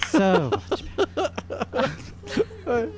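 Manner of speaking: slow, high, giggling through it; laughing. Real, not acted. Player in character admiring the new look, laughing. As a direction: drag it out while cracking up.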